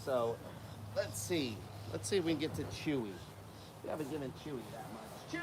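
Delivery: usual speaking voice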